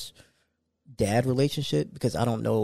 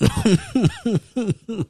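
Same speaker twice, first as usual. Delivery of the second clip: high laugh; 13 semitones higher, 6 dB louder than usual. Real, not acted. Laughing at crying babies.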